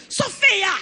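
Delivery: put-on high, sharp shout of the child's name; mock outraged yell at a naughty child; scolding mother. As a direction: call her out angrily. Mother losing temper at daughter.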